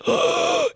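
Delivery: a sharp high gasp for air; imitated wheezing gasp. Comedian acting out his covid wheezing, imitated gasp. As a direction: gasp like lungs won't fill. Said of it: "just wheezing on the couch".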